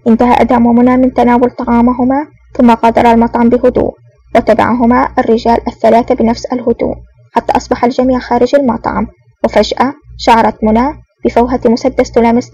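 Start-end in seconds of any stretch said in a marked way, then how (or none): none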